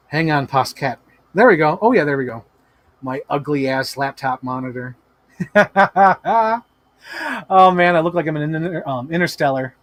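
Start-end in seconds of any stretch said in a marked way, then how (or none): 5.4-6.6 quick loud laugh; brief real laugh; short laugh. Real, not acted. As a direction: laugh at the monitor. Laughs at ugly monitor.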